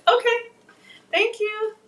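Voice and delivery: high, loud sing-song okay; high-pitched. Real, not acted. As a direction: chirp it in a high voice. Son's one-word answer.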